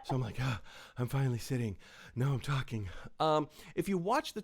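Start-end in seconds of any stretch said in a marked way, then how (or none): 0.4-2.9 out of breath. Real, not acted. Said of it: "I'm out of breath".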